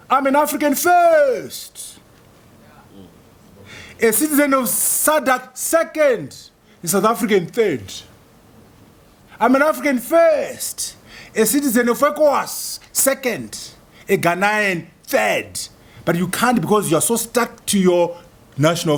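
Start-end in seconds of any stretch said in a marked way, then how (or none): 0.1-7.8 proud pledge. Shown as a demonstration. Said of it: "you must say". 9.4-15.7 proud pledge. Shown as a demonstration. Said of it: "you must say".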